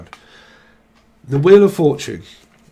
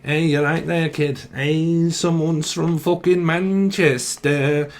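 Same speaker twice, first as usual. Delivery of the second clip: drawn-out, exaggerated Manchester accent; slow, hammed-up Manchester drawl; northern English accent; 3 semitones higher, slower than usual. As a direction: stretch the vowels like a Mancunian. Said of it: "someone's from up north as well".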